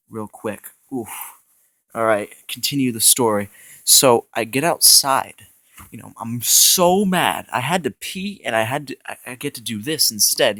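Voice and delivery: ordinary talk, nothing marked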